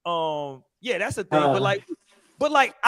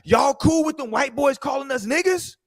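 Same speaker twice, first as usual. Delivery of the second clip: angry teammate; 8 semitones higher than usual. Retold or imitated. Angry teammate voiced in story.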